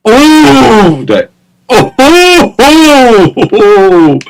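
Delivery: long high-pitched "ooh" of frustration; wailed groan, starting high and sliding down; annoyed groan. Real, not acted. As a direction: let out a string of drawn-out, exasperated ohs. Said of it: "that's so annoying".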